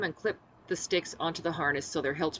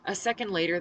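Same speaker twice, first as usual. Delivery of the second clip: plain reading voice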